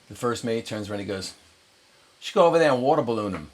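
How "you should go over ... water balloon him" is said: quick, higher imitation of an Australian; louder, imitated Australian accent. Australian first mate suggests a prank, Australian accent. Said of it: "He's Australian".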